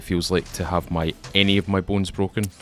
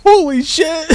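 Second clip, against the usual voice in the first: high loud thrilled yell; being chased; 7 dB louder, slower than usual. Real, not acted. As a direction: shout in disbelief. Dodging an enemy shot.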